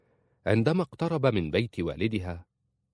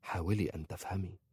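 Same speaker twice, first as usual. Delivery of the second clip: soft, gentle plea; 8 dB quieter than usual. A narrator's reading. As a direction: coax kindly in a hushed voice. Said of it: "قال في رفق".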